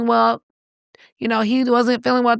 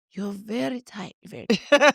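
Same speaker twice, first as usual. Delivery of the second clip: imitated masseur; 3 dB quieter than usual.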